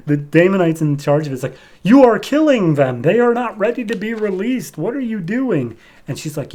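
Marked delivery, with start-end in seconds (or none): 1.8-5.8 higher, louder panicked objection; a scientist's horrified protest; alarmed protest. Acted. Scientist watching his clones destroyed, higher panicked outcry.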